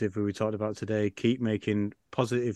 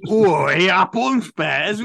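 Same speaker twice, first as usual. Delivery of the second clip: loud, high, slow, badly put-on Cockney accent; terrible Cockney accent; 8 semitones higher, 8 dB louder, slower than usual. Host fumbles the intro in a loud, terrible Cockney accent. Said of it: "terrible Cockney accent".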